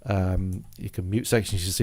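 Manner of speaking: normal talking voice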